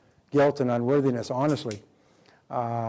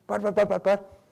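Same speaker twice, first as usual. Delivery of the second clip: flustered sputter; 7 semitones higher than usual. Retold or imitated. Mimicking flustered manipulator.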